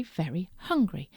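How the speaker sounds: ordinary delivery, nothing marked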